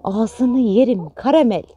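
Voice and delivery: slow, drawn-out doting coo. Real, not acted. Doting on her pet hen Karamel, slow and adoring. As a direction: savour every word, adoring.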